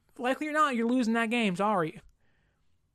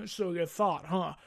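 mocking refs; 5 semitones lower, slower than usual. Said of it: "the refs are like".

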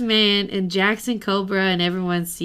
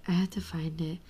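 whispering; 3 semitones lower, 12 dB quieter than usual. Real, not acted. Muttering while searching the book.

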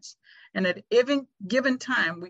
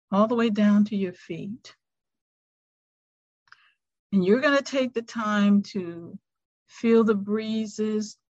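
guided meditation. Acted. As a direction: ease them into stillness, unhurried. Leads a five-minute meditation.

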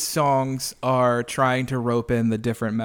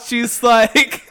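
normal talking voice

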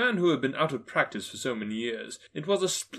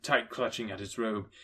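ordinary delivery, nothing marked

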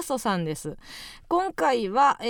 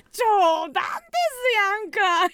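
laughing mock joke; 9 semitones higher, 4 dB louder, slower than usual. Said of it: "言いたかったよ".

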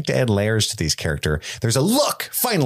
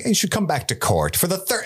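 casual Viserys impression; 9 semitones higher than usual. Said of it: "he's like".

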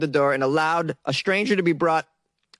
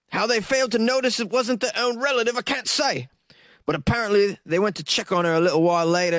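put-on British accent; 3 semitones higher than usual. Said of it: "I can't do this accent anymore".

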